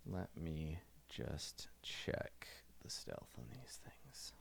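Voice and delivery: hushed aside to himself; whisper. Real, not acted. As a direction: mutter it under your breath. GM checking stealth to himself.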